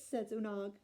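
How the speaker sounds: plain reading voice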